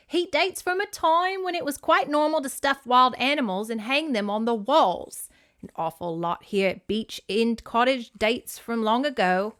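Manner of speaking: lilting British great-aunt explaining; bad British accent. Great aunt explaining stuffed animals to Mandy, bad British accent.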